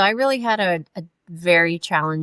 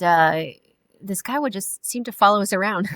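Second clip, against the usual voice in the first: usual speaking voice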